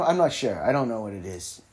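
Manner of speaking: ordinary talk, nothing marked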